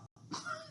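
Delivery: a short high giggle after stumbling over the word; embarrassed laugh. Real, not acted. Teacher laughs after tripping over the suffix, a short high giggle. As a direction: laugh at your own stumble. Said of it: "see, it's really hard".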